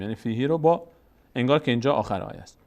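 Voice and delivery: ordinary talk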